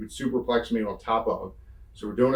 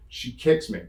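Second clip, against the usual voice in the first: ordinary talk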